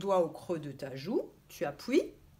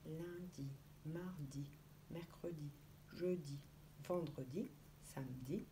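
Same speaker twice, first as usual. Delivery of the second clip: much softer, reciting under the breath; days said softly; 4 semitones lower, 13 dB quieter than usual. Shown as a demonstration. Teacher recites the days softly, as if in her head. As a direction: murmur the days as if saying them in your head. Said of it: "quand on récite ... dans sa tête".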